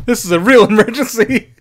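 laughing. Real, not acted. Laughing reaction to scene.

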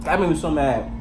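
chewing while talking; talking around a bite; mouth full. Real, not acted. Seen 1: Cheeks puffed, mouth working on bread bitten just before.

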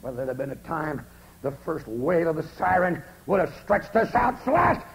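ordinary delivery

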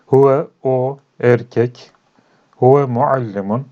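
Huve spoken slowly and clearly; slow model reading. Shown as a demonstration. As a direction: draw out Huve, o erkek for learners. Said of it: "teacher slowly models Arabic word".

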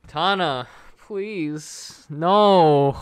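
loud, high plea breaking into a shouted no; dismayed cry. Real, not acted. Loses a pull in game.

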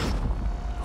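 sharp voiceless intake of breath; startled gasp. Real, not acted. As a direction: gasp in fright. Startled by hands dragging them.

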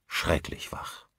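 plain reading voice

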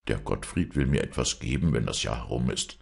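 usual speaking voice

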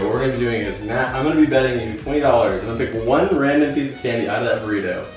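usual speaking voice

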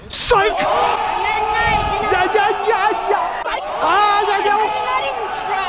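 high shouted yeahs; celebrating. Real, not acted. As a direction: whoop it up. Celebrating catch.

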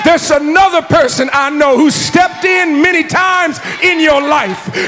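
loud, high, fervent sermon climax; rally the congregation with rising fervor; preaching at full force. Acted. Preacher urging the congregation to stand up for God, loud and fervent.

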